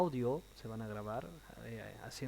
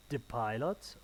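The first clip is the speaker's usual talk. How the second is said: "The Pilots" lifting into a question; questioning upturn on "Pilots"; rising intonation; slower than usual. Shown as a demonstration. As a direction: let "Pilots" rise at the end. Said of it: "rising intonation".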